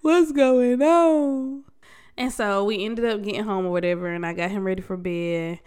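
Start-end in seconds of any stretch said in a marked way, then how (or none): 0.0-1.6 loud, slow mock wail; hammed-up wailing plea, rising higher at the end; retold bawling. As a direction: cry out to the Lord, drawn out. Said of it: "I was just bawling".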